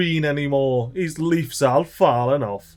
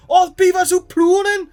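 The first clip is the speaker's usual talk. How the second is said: high, loud, gleeful cheer; excited cheer; 3 dB louder than usual. Real, not acted. Streamer cheering on his beavers, a high loud whoop. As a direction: whoop it up like a proud fan.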